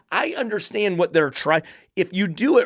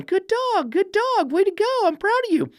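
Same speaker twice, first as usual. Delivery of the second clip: high sing-song "good dog"; mocking dog-praise voice. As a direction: parody an owner praising the dog. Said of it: "I need to start going".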